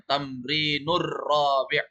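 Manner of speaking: slow, "Tamrinur rabi'" with the r held and doubled into "rabi'"; teacher drills the joined r slowly; linked r. Shown as a demonstration. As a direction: lean on the rolled r of "Tamrinur rabi'". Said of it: "Kasih masuk ro-nya".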